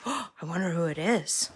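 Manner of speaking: hushed gasp, then soft wonder. A narrator's reading. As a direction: drop to a hush after a gasp. Suspense in story.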